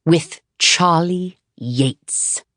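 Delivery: slow, weighty reveal of the name; grand finale. Acted. Manager revealing the famous name, slow and grand. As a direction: drop the name like a big reveal. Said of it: "he got to his grand finale".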